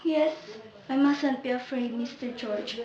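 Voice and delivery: a scared voice shrinking away; frightened hush. Acted. Sabina tells George her fear, soft frightened murmur.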